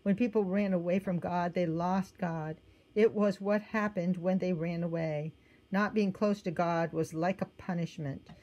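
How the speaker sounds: ordinary delivery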